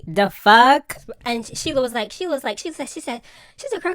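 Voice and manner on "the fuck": punched out loud with a rise; outraged the fuck. Real, not acted. Vlogger blurting disbelief at Michael's apology.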